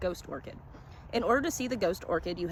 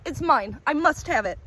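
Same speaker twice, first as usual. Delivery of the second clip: loud, higher, frantic; greedy cry; 7 semitones higher, 7 dB louder than usual. A narrator's reading. Collector lunges for the orchid, loud and frantic. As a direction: grab at it with desperate greed.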